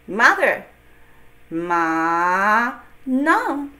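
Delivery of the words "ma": Ma rising; second tone ma. Shown as a demonstration. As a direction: lift Ma upward. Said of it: "So ready?".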